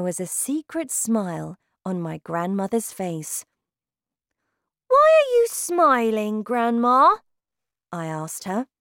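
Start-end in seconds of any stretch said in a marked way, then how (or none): 4.9-7.2 put-on high, loud child's question; curious boy. A narrator's reading. Boy questions his smiling grandmother, curious high voice. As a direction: ask brightly as a puzzled boy.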